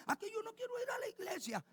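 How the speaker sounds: very high, petulant refusal; whining child. Retold or imitated. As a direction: sulk like a kid who won't go.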